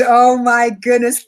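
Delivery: ordinary delivery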